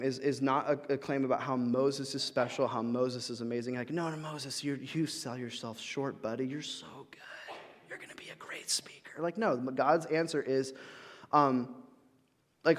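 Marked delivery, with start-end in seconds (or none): 3.9-9.2 soft, hushed, coaxing pep talk; mock flattery. Imagined God flattering Moses, a soft hushed mock pep talk. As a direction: coo the reassurance like a mock cheerleader.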